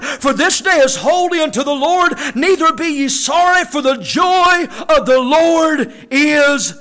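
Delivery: loud, proclaiming Bible reading; preacher booming the text of Nehemiah; scripture read in preaching voice. Acted. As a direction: ring the verses out to the congregation. Reads scripture aloud.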